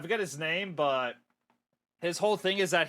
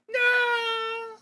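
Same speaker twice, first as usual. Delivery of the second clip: very high, loud cartoon wail; long, high-pitched cry of dismay; dismayed scream; 5 dB louder than usual. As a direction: scream it out in horror. Attack goes the wrong way.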